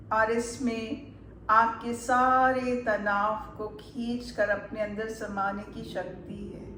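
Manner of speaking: calm meditation guide, unhurried with long pauses; measured guided-relaxation voice, lingering on soothing words. Acted. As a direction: ease the listener into relaxation, phrase by phrase.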